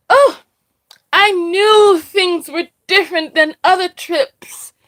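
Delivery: high, loud dramatic "oh" and aggrieved complaint; mock dismay. Speaker mimics the mother finding the trip has changed, dismayed.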